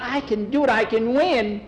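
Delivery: put-on high, insistent boy's plea; desperate kid convincing an adult; begging. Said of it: "Skeeter was begging".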